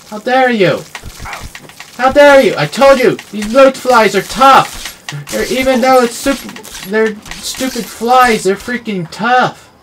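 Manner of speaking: high, loud mock outrage; shaking with anger. Real, not acted. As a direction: fume at the tough flies. Hit by bloatfly, indignant.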